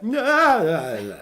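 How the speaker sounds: high, loud "nah" dropping away; imitated silly cry. Clients requesting that thing he did, high imitated cry. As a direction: start high and loud, then fall off. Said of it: "we want you to do that".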